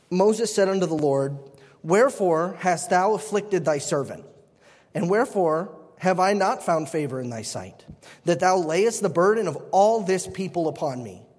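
solemn King James reading; formal Bible reading voice; scripture reading. Acted. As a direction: read the verse with weight. Reads King James scripture aloud.

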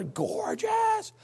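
very high, drawn-out gush; exaggerated gushing. Preacher raving about secondhand brown shoes, hammed-up gushing. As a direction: squeal it in over-the-top admiration.

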